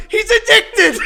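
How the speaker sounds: high, loud imitated heckle; shouted imitation bursting into laughter; mock outcry. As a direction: yell it like a joking friend. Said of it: "And then Greg goes".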